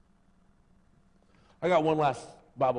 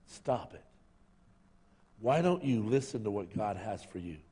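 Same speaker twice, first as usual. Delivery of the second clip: ordinary talk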